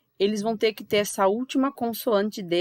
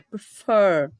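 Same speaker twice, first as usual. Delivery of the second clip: "Prefer" with "fer" long and louder; stress on final syllable; 5 dB louder than usual. Shown as a demonstration. Teacher repeating the word for students, heavy final stress. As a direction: land hard on the end of "Prefer". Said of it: "A sílaba mais forte dele é o fer".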